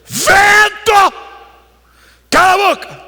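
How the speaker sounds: ordem berrada, muito aguda; grito. Acted. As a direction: berre com o vento em autoridade exagerada. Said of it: "ele não gritou". (grito teatral de comando)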